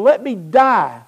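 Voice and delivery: emphatic acted curse; self-curse. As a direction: call the curse down on yourself. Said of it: "He invokes a curse on himself".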